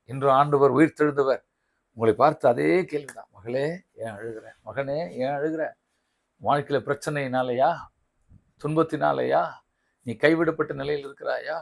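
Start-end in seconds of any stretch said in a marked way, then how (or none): 3.3-5.6 soft, low, fatherly asking; hushed, caring appeal; tender question. Acted. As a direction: gently comfort someone who is weeping. Voices the Lord's tender question.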